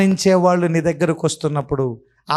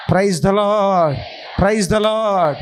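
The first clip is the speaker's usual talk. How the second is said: normal talking voice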